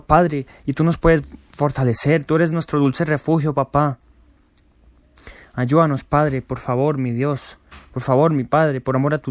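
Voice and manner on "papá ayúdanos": normal talking voice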